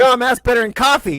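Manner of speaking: fast, loud wide-awake exclaim; jolted exclaim. Retold or imitated. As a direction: sound buzzed after the shock.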